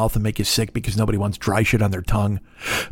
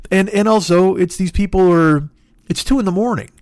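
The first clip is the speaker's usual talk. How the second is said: ordinary talk, nothing marked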